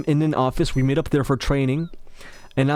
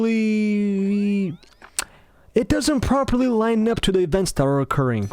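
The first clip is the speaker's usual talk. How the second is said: higher, halting imitation of a coworker; careful coworker; 5 semitones higher, slower than usual. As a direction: search slowly for tactful words. Coworker carefully explains herself.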